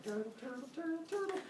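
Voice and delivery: soft, high turtle squeak, repeated; little high-pitched turtle chant; cartoon turtle. Turtle plodding along in a story.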